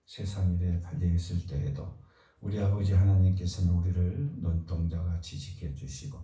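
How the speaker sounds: ordinary talk, nothing marked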